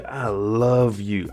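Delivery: drawn out very slowly; slow confession. Retold or imitated. Kev confessing love to Gemma, drawn out slowly. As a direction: stretch each word, pleading.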